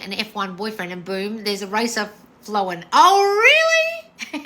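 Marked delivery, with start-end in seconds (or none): 2.9-4.5 high, loud, slow oh really, dissolving into a laugh; drawn-out disbelief with a giggle; amused surprise. Real, not acted. Host hearing a viewer manifested an F1 racer boyfriend, laughing surprise.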